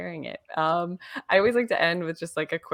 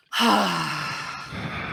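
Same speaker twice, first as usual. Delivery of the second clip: long open-mouth exhale; big slow breath out. Shown as a demonstration. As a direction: breathe out slowly and fully. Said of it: "taking a couple big slow breaths".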